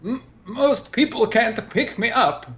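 high, strained protest; squeezed character line. Acted. Eric held up by the robot, high strained voice. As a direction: say it while being lifted. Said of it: "Eric quote in robot hug".

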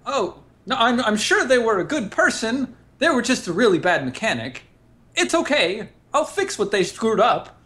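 high, hurried apology; flustered backpedal. Acted. Eric backpedaling to the angry crewmate, high flustered voice. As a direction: scramble to fix the insult. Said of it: "Eric backpedals".

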